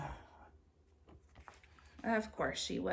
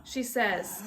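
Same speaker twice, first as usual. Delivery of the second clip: ordinary delivery, nothing marked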